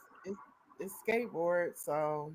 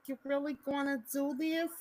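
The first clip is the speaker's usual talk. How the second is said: higher, softer imitation of a Minnesota accent; sister's Minnesota accent; 7 semitones higher, 6 dB quieter than usual. Imitating Joey's sister, in a Minnesota accent. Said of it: "The mom spoke like that".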